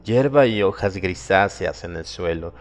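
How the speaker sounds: ordinary delivery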